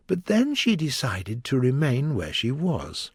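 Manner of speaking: usual speaking voice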